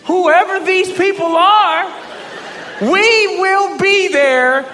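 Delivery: high, slow and laughing; accepting the tryout while laughing loudly; giddy reply. Retold or imitated. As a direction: stretch it out, barely holding in the laugh. His own giddy reply to the team.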